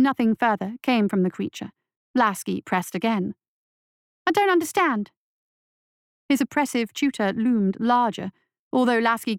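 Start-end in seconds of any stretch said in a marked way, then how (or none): 4.2-5.1 put-on higher, urgent young voice; scared boy. A narrator's reading. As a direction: ask it like a frightened kid. Boy pleads.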